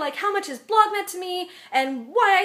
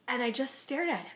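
usual speaking voice

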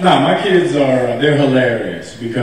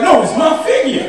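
high laughing protest; 3 dB louder than usual. Retold or imitated. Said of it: "I was like".

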